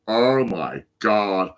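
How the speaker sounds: drawn-out, slow exclamation; reliving a dizzy spell. Retold or imitated. Retelling his head rush at the Baker's, slow exclamation. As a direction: stretch each word out slowly. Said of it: "I was like".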